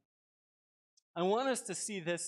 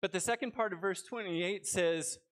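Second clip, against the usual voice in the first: normal talking voice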